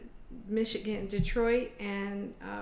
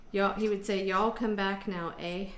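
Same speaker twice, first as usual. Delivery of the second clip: slow mock drawl on "Y'all come back now, eh?"; mock drawl mixing Southern and Canadian; uncle's mock Southern-Canadian drawl. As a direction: tease the way an uncle mocks a mixed accent. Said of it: "and he said".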